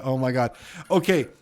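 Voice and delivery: ordinary talk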